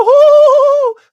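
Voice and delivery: high, loud falsetto "ohoho" laugh; cartoonish high-pitched giggle; mock squeaky giggle. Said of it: "That was literally how he talked".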